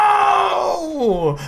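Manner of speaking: high roaring crowd yell; crowd shouting no. Acted. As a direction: shout it like a whole arena. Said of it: "The arena sings".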